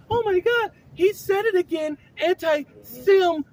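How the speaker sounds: put-on high, loud childish whine; mock a whining child, slowly stretching anti-sim; whiny child voice. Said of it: "You're using it like a child".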